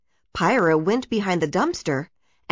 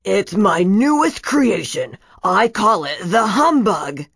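proud villain voice; 5 dB louder than usual. A narrator's reading. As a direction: boast about his invention. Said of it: "Brackus replied".

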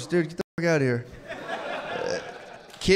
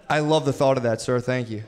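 ordinary talk

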